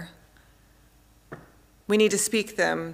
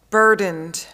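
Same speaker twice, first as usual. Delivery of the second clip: normal talking voice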